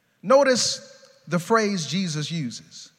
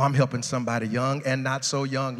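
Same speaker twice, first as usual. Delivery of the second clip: usual speaking voice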